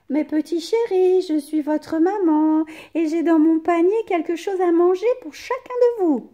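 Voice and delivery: high, loud calling mother; mother calling her kids home; mother goat calling. A narrator's reading. Mother goat returning home, calling high and loud. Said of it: "dit-elle".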